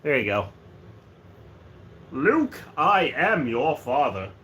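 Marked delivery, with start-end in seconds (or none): 2.1-4.3 slow, solemn villain voice; dark-lord father impression. Streamer in new hat revealing he is Luke's father, slow villain impression. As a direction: intone it slowly and weightily. Famous Darth Vader line, acted.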